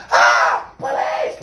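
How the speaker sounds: imitating her yelling police, half-breathed; hammed-up alarm call; mimicking the woman who called the cops; mock scream for police. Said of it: "she came out ... right there when I went".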